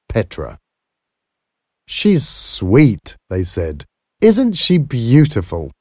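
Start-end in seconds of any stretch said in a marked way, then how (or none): 1.8-3.2 high admiring coo. A narrator's reading. Visitors admire the new baby. 4.2-5.7 high, delighted admiration; admiring. A narrator's reading. Visitors admire the new baby.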